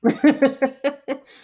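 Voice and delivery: loud high burst of laughter; laughing through the telling. Real, not acted. Laughing while retelling telling off a coworker, loud laughter. Laughs mid retold quote.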